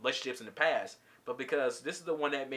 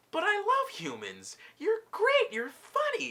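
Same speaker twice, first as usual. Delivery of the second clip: high, cooing mock sweetness; imitating a doting sweetheart in a high pitch; imitated gushing. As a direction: put on a high, adoring voice. Rose gushing, voiced by teller.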